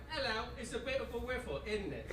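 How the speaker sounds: lightly put-on posh British lilt; a hint of a proper English lady; mock British accent. Comic to the crowd, British lady in a light British accent. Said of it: "A British lady who her whole life was like".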